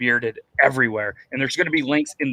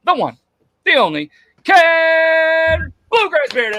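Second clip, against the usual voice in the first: high, loud, drawn-out name call; big announcer reveal; 14 semitones higher, 8 dB louder, much slower than usual. Pretend. Host calling out tonight's guest Ken, shouted announcer intro. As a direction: stretch the name out like a ring announcer.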